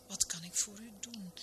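soft, courteous enquiry; mildly obsequious host; very polite. A narrator's reading. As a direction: offer help gently and deferentially. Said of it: "zo vroeg hij heel beleefd".

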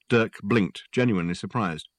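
ordinary delivery

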